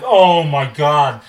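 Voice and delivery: slow, loud "oh my God" of sudden shock; drawn-out alarm as the dead cat moves; shocked gasp. Retold or imitated. Tail suddenly moves, he panics.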